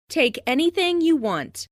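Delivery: ordinary talk, nothing marked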